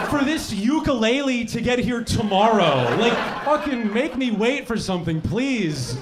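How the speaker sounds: half-laughing yelled tantrum; mock outraged rant. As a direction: rant in mock horror at fast shipping. Ranting at fast delivery.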